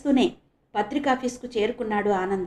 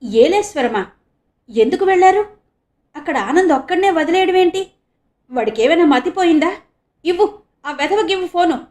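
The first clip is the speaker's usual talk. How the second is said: high, loud, frantic questions; a father panicking at bad news; panicked; 7 semitones higher, 7 dB louder than usual. A narrator's reading. Father panics on the phone about his missing son, frantic. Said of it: "గాభరాగా భయంతో బిగుసుకుపోతూ".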